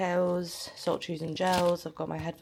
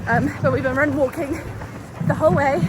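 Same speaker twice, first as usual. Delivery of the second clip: out of breath; 6 semitones higher, 4 dB louder than usual. Real, not acted. Talking mid run.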